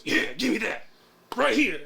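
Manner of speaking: a hint of a manic stranger; eager client voice. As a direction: mimic the excited weirdo. Imitating the client's eager demand.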